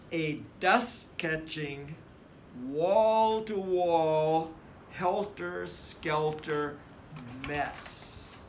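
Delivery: each word drawn out, wall-to-wall slow and loud; emphatic build. A narrator's reading. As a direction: lean hard into every word of the mess. Emphatic list describing the mess.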